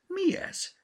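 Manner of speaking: teacher cries out at the mess; exclaimed. A narrator's reading. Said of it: "kiáltotta Judit néni". (meglepett felkiáltás, sokkal magasabban)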